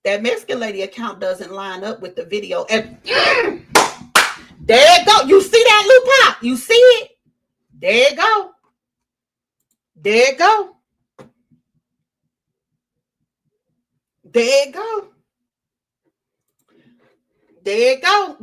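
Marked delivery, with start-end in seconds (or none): 3.0-7.1 loud excited cry, higher; real excitement, calling it out loud. Real, not acted. Streamer calling out to Lou Pop, loud and excited. 7.8-18.4 triumphant. Real, not acted. Repeating triumphantly.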